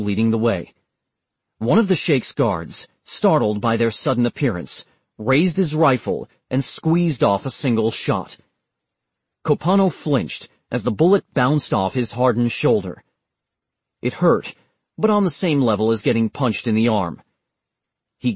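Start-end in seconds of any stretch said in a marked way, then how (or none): none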